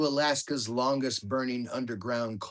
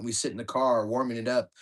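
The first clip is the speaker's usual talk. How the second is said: ordinary delivery, nothing marked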